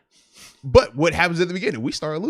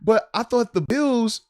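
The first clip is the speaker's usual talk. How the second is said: put-on higher-pitched puzzled kid; a child's puzzled protest, pitched up; confused little son; 8 semitones higher, slower than usual. Son questioning dad about the score, confused.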